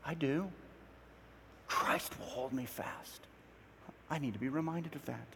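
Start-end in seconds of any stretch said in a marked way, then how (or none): 1.7-3.3 slow, breathy soft whisper of the lyric; hushed hymn line. Retold or imitated. Preacher quoting the hymn to the congregation, soft slow whisper. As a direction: murmur it like a private comfort.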